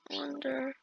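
ordinary talk, nothing marked

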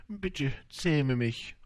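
slow, earnest request; gentle plea. A narrator's reading. Fox asking the little prince to tame him, pleading.

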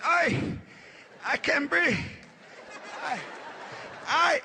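high, wheezy gasps for air; gasping uncle. Acted. Uncle on the couch gasping at the kids. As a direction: play the uncle struggling to get words out.

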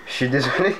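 apologizing through a laugh; sorry, giggling; laughing off the apology. Real, not acted.

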